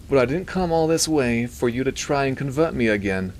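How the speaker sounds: high nasally voice aspiring to sound British; Milton. A narrator's reading. Doctor objecting to being converted by the priest, nasally. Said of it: "He had a nasally voice".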